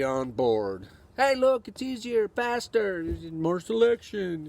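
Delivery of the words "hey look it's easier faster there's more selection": high-pitched mock enthusiasm; sneering imitation of a chirpy salesman. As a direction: parody an eager pitch, voice pushed high.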